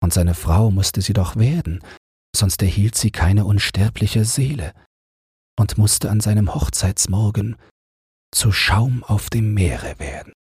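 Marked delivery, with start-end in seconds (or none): none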